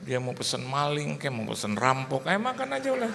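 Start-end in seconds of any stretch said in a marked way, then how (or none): 2.3-3.1 high-pitched go-ahead; lightly acted, sing it up high; indulgent urging. Father tells his son to eat whatever he likes, indulgent.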